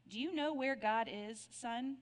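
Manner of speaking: usual speaking voice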